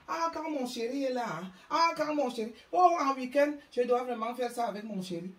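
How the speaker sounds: high, swooning mock gush about her man; mocking gushing woman. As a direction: parody a woman who only talks about her darling. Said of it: "il y a des enfants qui ne parlent jamais de leurs enfants".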